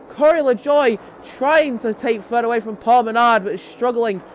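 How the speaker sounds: keen live call of a restart battle; lively race commentary. Real, not acted.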